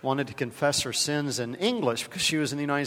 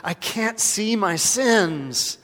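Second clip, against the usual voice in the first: a mild frustrated outburst, slow and a bit higher; 7 semitones higher, 8 dB louder, much slower than usual. Retold or imitated. Nervous woman in the confession booth, mildly frustrated. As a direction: let the frustration show as she gives up. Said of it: "So in obvious frustration".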